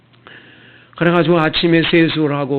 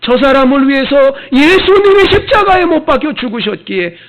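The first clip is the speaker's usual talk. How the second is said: a higher, louder call on 예수님이 with heavy stress; preaching at full force; 8 semitones higher, 6 dB louder than usual. Acted. Preacher proclaiming it to the congregation, full force. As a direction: cry it out to the whole church.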